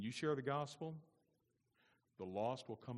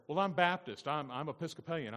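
louder, higher, smug self-assured quote; mocking smug excuse; 6 semitones higher, 10 dB louder than usual. Preacher mocking a neighbour's excuse, smug and loud.

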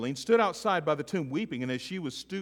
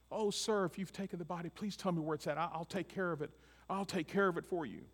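softer, higher pleading voice; mildly pleading Mary; 4 semitones higher, 6 dB quieter than usual. Acted. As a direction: beg the gardener gently.

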